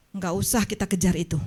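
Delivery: ordinary talk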